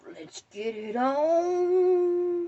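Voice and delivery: loud, high, very drawn-out final word; ring-announcer style battle call; hollered challenge. Acted. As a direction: stretch ON into a long rising holler.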